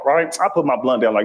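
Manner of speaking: ordinary talk, nothing marked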